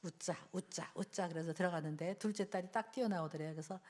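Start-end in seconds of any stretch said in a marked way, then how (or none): 0.0-1.3 self pep talk. Retold or imitated. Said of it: "자기가 그랬대요".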